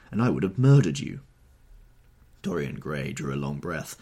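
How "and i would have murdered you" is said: loud, appalled realization; horrified cry. Acted. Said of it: "he cried".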